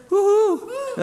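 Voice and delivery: high falsetto woo-hoo; imitating a cheer. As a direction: mimic the cheer from the front row. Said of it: "that was Mark Anderson".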